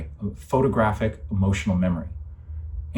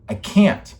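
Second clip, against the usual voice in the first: louder and higher, breaking out; desperate outburst; 6 semitones higher, 6 dB louder than usual. Real, not acted. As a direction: cry it out in frustration. Repeats 'I can't' in despair.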